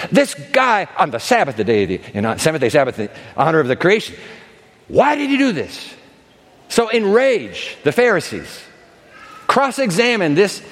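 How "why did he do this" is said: indignant. Acted. Voicing the outraged Pharisees.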